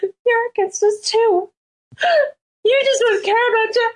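high, hammed-up crying that swells into a shout; mock tearful outburst. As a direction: play the wounded girlfriend who cries, then yells at him to leave. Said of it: "Jerica starts to cry".

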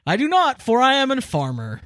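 a touch of pompous proclamation; grandiose mock farmer.

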